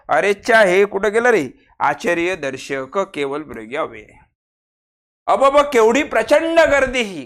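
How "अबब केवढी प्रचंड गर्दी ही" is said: higher, excited "अबब"; astonished cry; amazed exclamation. Shown as a demonstration. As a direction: marvel at the crowd. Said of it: "आश्चर्य झालं".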